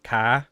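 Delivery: "Ka" with no R at all; Boston-style "Ka". Shown as a demonstration. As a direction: drop the R: "Ka". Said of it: "do you just say".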